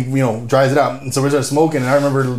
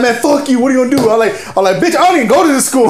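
high-pitched cocky comeback, retold laughing; hammed-up student backtalk; mock defiance; 10 semitones higher, 3 dB louder than usual. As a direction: talk back to the teacher, amused.

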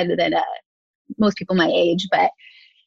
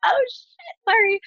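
high, sheepish mock apology; squeaked comic backpedal; embarrassed apology. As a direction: reenact an awkward oops, voice shooting up. Retold embarrassed apology to mentor.

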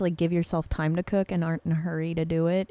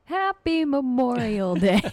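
sing-song greeting; 6 semitones higher, 4 dB louder than usual. Acted. Festive greeting to open show.

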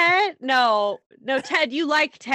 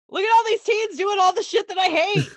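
high, mock-delighted killer voice; gleeful killer; 8 semitones higher than usual. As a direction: gloat over your victims in a high put-on voice. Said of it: "He's like".

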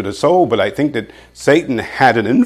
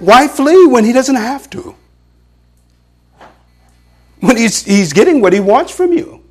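high, louder preaching climb; fiery preaching; 10 semitones higher, 4 dB louder than usual. Acted. Pastor warning the congregation about Satan, fiery preaching. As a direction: preach it with rising fire.

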